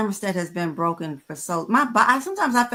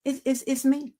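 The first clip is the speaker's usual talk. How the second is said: lightly voiced worried husband, higher; Gregory pleading; 7 semitones higher than usual. Husband asking what he's doing wrong, higher and stammering. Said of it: "Gregory's like".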